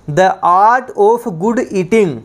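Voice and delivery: very slow, each English word spaced for note-taking; slow dictation. Shown as a demonstration. Teacher dictating to students, very slow and spaced. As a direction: read the definition out word by word, slowly.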